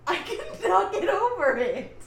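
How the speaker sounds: laughing through the words. Real, not acted. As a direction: break into laughter. Laughing at Archie's voice.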